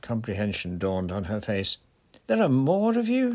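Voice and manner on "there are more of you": high, loud, astonished question; stunned. A narrator's reading. Lavender realising more centaurs exist, stunned. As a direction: ask in stunned disbelief, pitched way up. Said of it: "she said, stunned".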